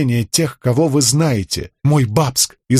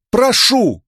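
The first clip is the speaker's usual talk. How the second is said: отчаянный выкрик; 12 semitones higher than usual. A narrator's reading. Мольба к чудищу.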